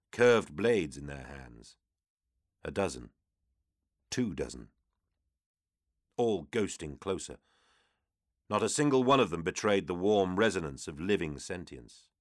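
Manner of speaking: ordinary delivery, nothing marked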